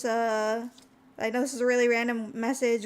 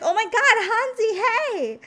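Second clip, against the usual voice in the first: much higher and louder delighted Oh my God; excited greeting; 10 semitones higher, 8 dB louder than usual. Retold or imitated. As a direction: squeal the greeting at a friend you just spotted. Recognizing friend at game store.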